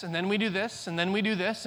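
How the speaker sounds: usual speaking voice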